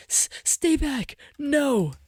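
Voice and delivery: high, panicked shriek; terrified scream. Acted. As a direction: scream it in terror. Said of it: "The cry dissolves".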